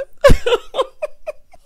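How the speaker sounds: high, loud peal of laughter; loud laughing. Real, not acted. Cracking up at her tattling-kid imitation, loud cackling.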